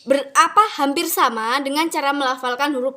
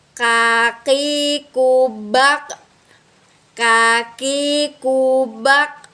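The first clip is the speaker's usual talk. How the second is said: slow drawn-out reading; much slower than usual. Shown as a demonstration. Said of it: "slow reading example".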